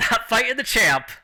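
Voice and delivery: high, loud, emphatic refusal; acted brisk brush-off; refusing fighter. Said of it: "And she's like".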